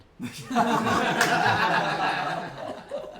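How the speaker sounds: loud high burst of laughter. Real, not acted. The speaker laughs hard at his own wailing-wife joke, loud and high.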